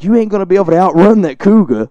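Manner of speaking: mock the kid's chances in a Louisiana accent; Cajun accent. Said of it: "a very strange accent".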